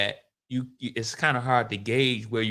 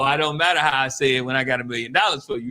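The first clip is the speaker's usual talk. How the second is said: normal talking voice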